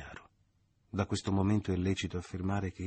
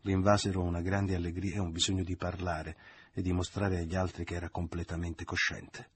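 plain reading voice

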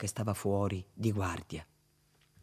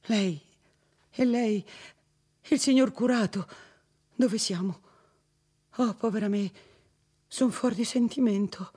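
high, bewildered cries of recognition; dazed exclamation; 9 semitones higher, 4 dB louder than usual. Acted. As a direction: call out half lost, half relieved. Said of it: "esclamò".

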